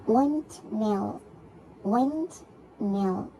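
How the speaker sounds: "Windmill" split by a gap, wind, mill; syllable by syllable. Shown as a demonstration. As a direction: give "Windmill" as two separate beats. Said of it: "two syllables".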